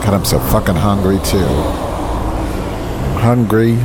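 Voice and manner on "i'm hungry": whiny drawn out. Real, not acted. Complaining to viewers about hunger, very slow drawn-out whine. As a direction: stretch it out slowly, very slow.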